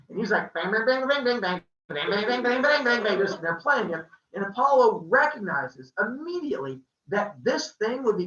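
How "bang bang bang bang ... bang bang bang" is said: twangy imitated instrument strums; strumming noises. Storyteller imitating Hermes playing the lyre at Apollo, twangy strumming. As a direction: rattle off rhythmic strummed twangs.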